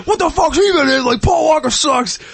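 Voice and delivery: friends snap back at the Paul Walker fan in outrage; mock angry outburst. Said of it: "people get pissed".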